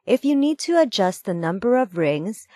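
normal talking voice

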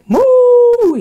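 high, loud playful moo; cow moo. Pretend. Cow sound for kids.

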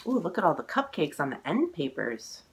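usual speaking voice

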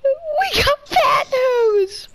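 shouting. Acted. Shouted game banter.

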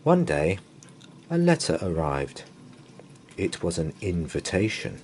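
ordinary delivery, nothing marked

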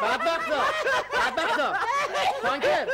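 high laughing taunt; laughing mockery. Mocking laughter at them.

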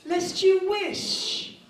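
slow, grandly indignant gentleman's threat; hammed-up Victorian menace, drawing out the key words; Victorian London tough guy. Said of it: "If this were nineteenth century Victorian London".

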